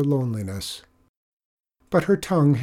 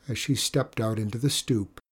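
ordinary delivery

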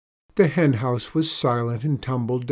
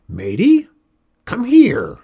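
a much louder, higher shout of the name; old witch calling her helper again, louder; louder yell; 11 semitones higher, 11 dB louder than usual. A narrator's reading. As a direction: bellow it, getting impatient. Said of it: "yelled Critch once more, louder".

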